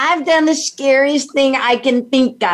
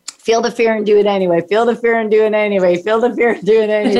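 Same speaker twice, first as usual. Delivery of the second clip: fast, looping chant under the breath; quick self-pep mantra on the way up in the plane; repeated mantra; much faster than usual. Retold or imitated. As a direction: rattle it off again and again to stay brave. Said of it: "I'm doing the mantra of that famous book".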